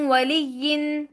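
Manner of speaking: म्यू वलियिन slow, नून merged into व with nasal ghunna; म्यू वलियिन with a long nasal hum; nasalized recitation. Shown as a demonstration. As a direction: draw the sound through the nose, slow. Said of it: "नाक के मासे में एक अलिफ के बराबर".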